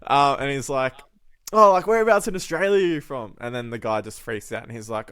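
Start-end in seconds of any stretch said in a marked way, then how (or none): 1.5-3.3 higher, louder imitated curiosity; eager questioning. Bloke quizzing the supposed Aussie, imitated eagerness. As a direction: play the keen Aussie pressing for details.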